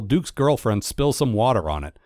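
ordinary delivery

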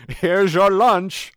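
very high, loud, slow announcement; butler serving lunch. Acted. Podcaster playing the scientist's butler serving lunch, loud announcing. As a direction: sing out the serving high and drawn out.

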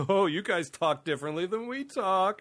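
high, slow, simple-minded taunt; exaggerated mock amazement. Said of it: "the mockery of".

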